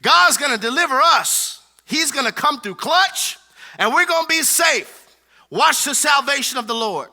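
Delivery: rally the people, sure of God; confident Moses. Retold or imitated. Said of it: "Moses is like".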